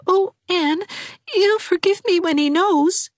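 put-on weepy young girl; tearful Dovey. A narrator's reading. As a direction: wail her misery.

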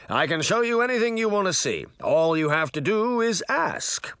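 high, boastful magic mirror; proud. A narrator's reading. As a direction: brag about your powers. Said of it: "Mirror said proudly".

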